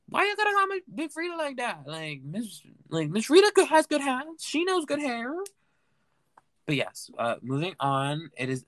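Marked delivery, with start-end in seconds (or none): 0.1-5.3 much higher, sassy offended queen. Retold or imitated. [name] answering [name]'s joke, high and offended. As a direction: play the insulted queen, way up high.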